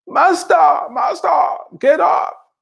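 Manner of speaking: high-pitched imitation of apostles yelling; panicked calling out. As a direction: act out frantic cries to wake the master. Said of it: "screaming and yelling and calling out".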